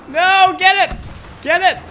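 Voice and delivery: loud high urgent shouts; frantic yelling. Real, not acted. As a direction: yell at the screen to hurry. Urgent game objective.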